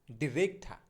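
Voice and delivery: ডিরেক্ট ঠা joined, ending in aspirated "tha"; corrected pronunciation. Shown as a demonstration. Teacher correcting director, ডিরেক্ট ঠা with open final "tha". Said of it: "সঠিক উচ্চারণটা যেটা হবে".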